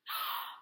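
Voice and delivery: sharp high gasp of delight; excited gasp. Acted. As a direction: gasp in wonder.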